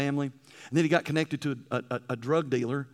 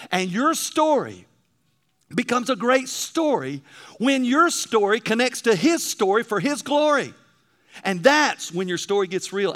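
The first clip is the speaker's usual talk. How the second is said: raised, ringing pulpit voice, pitch up; full-force preaching; 6 semitones higher, 8 dB louder, slower than usual. Acted. Preacher urging the congregation to tell their story, full-force preaching. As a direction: drive it home to the pews like a revival preacher. Sermon climax on telling story.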